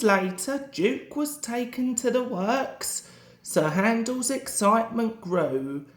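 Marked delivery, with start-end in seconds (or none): none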